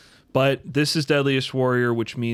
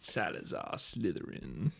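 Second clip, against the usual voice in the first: mock-sinister villain name; 4 semitones lower, 12 dB quieter than usual. Co-host finishing the sim master's introduction, mock-sinister low voice. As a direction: intone the name low and hushed, like a dark wizard.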